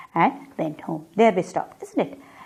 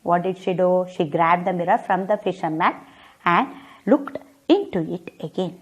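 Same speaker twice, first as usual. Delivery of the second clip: usual speaking voice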